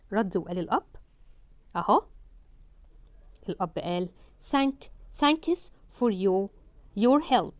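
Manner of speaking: ordinary talk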